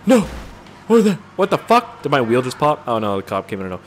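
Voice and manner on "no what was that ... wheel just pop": loud, high alarmed outburst; shocked cry at the game. Real, not acted. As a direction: yell it out in sudden alarm. Reacting to the hit in game.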